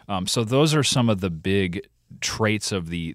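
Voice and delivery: ordinary talk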